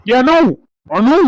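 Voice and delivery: loud call across the house, voice pitched high; shouting for Anu. Acted. Husband arriving home shouts for his wife. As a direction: bellow her name to find her. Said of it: "ஏன் கத்துறீங்க?".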